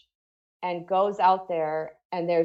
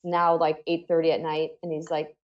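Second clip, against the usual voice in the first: ordinary delivery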